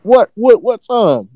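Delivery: high, disbelieving what-what; shocked. Retold or imitated. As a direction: squeak out the question in disbelief. Reacting to sudden shift.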